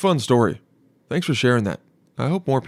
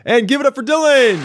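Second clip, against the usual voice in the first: shouted much higher and louder like a host; announcer shout; 13 semitones higher, 6 dB louder than usual. Pretend. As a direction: hype the crowd for Dylan. Imagined party announcing him.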